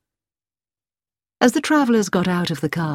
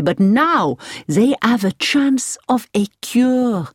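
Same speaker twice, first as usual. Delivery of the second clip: higher, emphatic French-driver voice full of enthusiasm; enthusiastic; 5 semitones higher, 3 dB louder than usual. A narrator's reading. Driver praises the hospital to Hilary, enthusiastic. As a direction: sing the hospital's praises with gusto. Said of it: "the driver said with enthusiasm".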